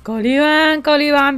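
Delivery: loud drawn-out greeting call; llamando a un recién llegado. Real, not acted. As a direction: call out the name, stretching it. Greets arriving viewer by name. (saludo a gritos, alargado)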